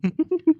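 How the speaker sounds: a sudden high laugh of disbelief; high burst of laughter. Acted. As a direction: laugh out, amused and exasperated. Husband laughs at wife's confession. (மனைவியின் செயலைக் கேட்டு உயர்ந்த சிரிப்பு)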